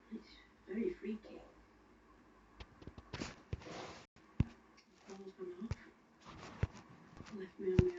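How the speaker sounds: low hushed whisper in the dark; scared whisper. Real, not acted. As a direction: keep it barely audible, spooked.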